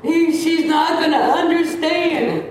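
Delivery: high, drawn-out mock holler; imitated hollering. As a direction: mimic a patient hollering on and on. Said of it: "He was hollering".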